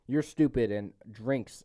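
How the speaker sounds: normal talking voice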